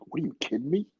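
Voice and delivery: incredulous. Retold or imitated. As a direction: ask it like you can't believe it. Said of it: "this is me talking to him".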